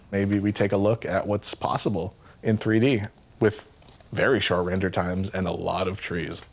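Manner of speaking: ordinary talk, nothing marked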